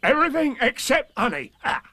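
very high, emphatic tiger voice; Tigger. A narrator's reading. Tigger correcting Pooh about his tastes, high and emphatic. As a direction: correct it loudly as a bouncy tiger. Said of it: "said Tigger".

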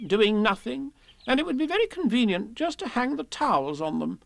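high, fast, bustling rabbit voice; a busy host making the best of it; Rabbit, chatty and fussy. Acted. Rabbit's voice in the story.

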